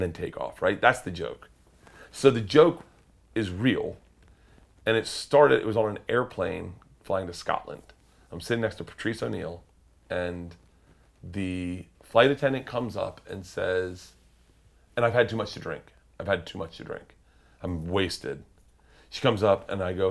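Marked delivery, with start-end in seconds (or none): none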